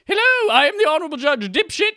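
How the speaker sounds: high, silly mock judge; honorable judge voice. Podcast host as judge opening game court, high mock-judge voice. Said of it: "And I will also be the judge".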